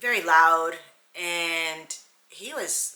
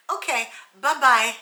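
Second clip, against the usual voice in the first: higher, louder sing-song bye-bye; sassy dismissal; 5 semitones higher, 7 dB louder than usual. Retold or imitated. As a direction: send him off with a bright sarcastic wave. Dismissing client after twisting arm.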